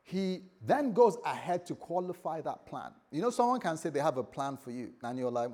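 ordinary talk

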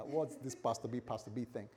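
usual speaking voice

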